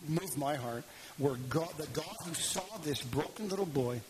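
ordinary talk, nothing marked

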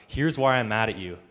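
loud, pointed accusation as Jonah; angry. Acted. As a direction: throw it out as an emphatic reproach. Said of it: "and he became angry".

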